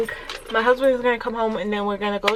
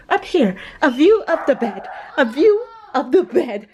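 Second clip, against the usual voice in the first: laughing; 4 semitones higher than usual. Real, not acted. Laughs at photo placement.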